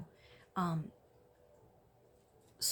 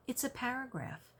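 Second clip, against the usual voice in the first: normal talking voice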